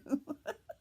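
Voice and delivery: short, high-pitched real laugh; amused laugh. Real, not acted. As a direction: let out a brief, high laugh. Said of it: "It's probably not funny".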